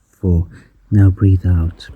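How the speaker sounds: ordinary talk, nothing marked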